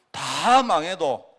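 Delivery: loud and very slow, each syllable weighed; emphatic preaching. Real, not acted. Preacher stressing God's warning to the church, loud and slow. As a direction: lean hard on every syllable.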